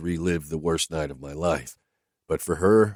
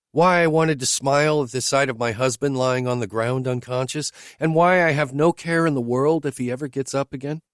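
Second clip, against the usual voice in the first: higher, lightly voiced woman asking bluntly; higher woman's voice, questioning her driver; Whitney's voice; 8 semitones higher, 3 dB louder than usual. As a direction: lift into her voice, direct and searching.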